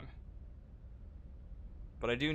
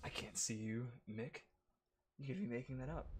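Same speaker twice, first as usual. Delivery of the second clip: whispering; 10 dB quieter than usual. Real, not acted. Teasing chat quietly.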